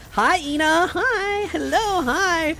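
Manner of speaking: high-pitched, drawn-out cooing hello; cooing greeting. Real, not acted. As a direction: coo hello like to a little one.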